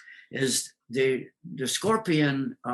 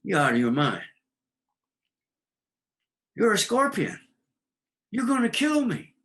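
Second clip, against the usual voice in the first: high, alarmed frog voice, rising sharply; a frog who thinks you've lost your mind; indignant frog, pitched up high; frog refusing; 9 semitones higher than usual. Acted. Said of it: "The frog looks at the scorpion and says".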